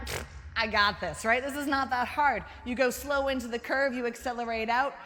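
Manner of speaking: loud, swaggering self-assurance; cocky bravado. Retold or imitated. Herself before the race, cocky loud bravado. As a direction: brush it off like it's easy.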